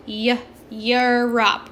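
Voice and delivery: isolated "yuh", then EUROPE drawn out as yur-up; Y sound. Shown as a demonstration. Teacher shows the E in Europe making a Y sound, slowly sounded out. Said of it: "is making that Y sound".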